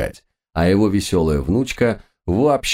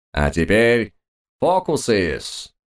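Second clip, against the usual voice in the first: high, slow showman's announcement; booming circus ringmaster; 4 semitones higher, slower than usual. Acted. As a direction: drag out the announcement grandly. Magician announces tricks.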